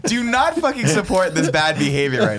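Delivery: high, loud playful yelling; mock outrage. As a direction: shout it in fake indignation.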